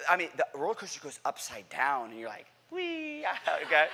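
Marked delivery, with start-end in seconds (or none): 2.7-3.2 high, soft, drawn-out whee; thin little squeal of glee; mimed ride squeal; gleeful "whee". Acted. Rider going upside down.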